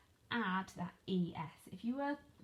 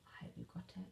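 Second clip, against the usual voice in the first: much softer, breathed out; whispered hope; 9 dB quieter, faster than usual. Real, not acted. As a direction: say it almost under the breath. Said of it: "quiet hope to pupils".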